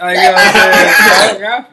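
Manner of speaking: drawn-out, high, loud "I forgot"; mock dramatic forgetting. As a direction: stretch it slowly and loudly. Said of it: "he said he".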